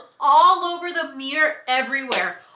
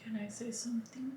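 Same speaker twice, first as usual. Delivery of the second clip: whisper; 4 semitones lower, 18 dB quieter, faster than usual. Real, not acted. Asks to speak, whispering.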